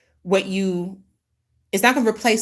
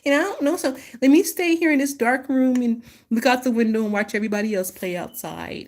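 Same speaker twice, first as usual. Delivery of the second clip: wimpy scared voice; 4 semitones higher than usual. Retold or imitated. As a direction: sulk fearfully at the window. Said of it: "And you're like".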